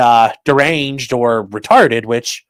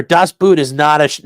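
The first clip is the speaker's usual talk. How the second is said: ordinary talk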